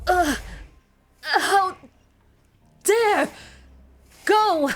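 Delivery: high, loud frantic yells; shouting. Real, not acted. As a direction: shout in panic. Frantic reaction during escape.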